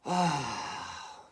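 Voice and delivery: long breathy sigh. Real, not acted. Smoker settling down before a morning song, long sigh. Sighs before starting to sing.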